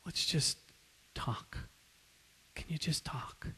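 soft, hushed plea; calm him down with a whispered ask; gentle plea. Retold or imitated. Pastor gently urging an angry friend to talk, hushed. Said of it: "Paul gently grabs his arm and says".

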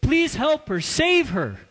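high, loud frantic scream for help; man carrying his girlfriend into the ER, screaming desperately. Acted. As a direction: scream for someone to save her.

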